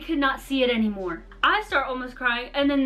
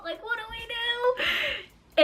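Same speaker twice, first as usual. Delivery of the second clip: drawn out, much higher helpless plea; high desperate wail; 10 semitones higher, 4 dB quieter, much slower than usual. Retold or imitated. Girls on a sinking paddle boat, desperate high wail. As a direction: let it rise into a slow wail of despair. Stranded girls problem solving.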